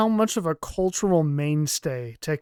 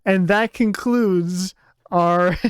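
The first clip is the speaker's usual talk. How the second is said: laughing; 4 semitones higher, 5 dB louder, much slower than usual. Real, not acted. Laughs while signing off.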